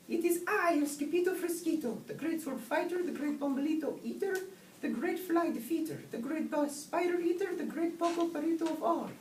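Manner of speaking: very high, grandly announcing kitten voice; boastful kitten hero. A narrator's reading. Skipito lists his titles to the voice inside, high boastful announcement. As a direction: proclaim each title in a high squeaky voice.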